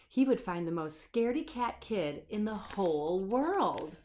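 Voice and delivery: ordinary delivery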